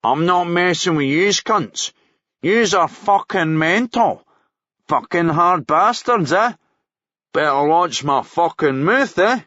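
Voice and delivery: cocky Glasgow ned. Acted. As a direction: taunt them cockily. Cocky prisoner taunts them.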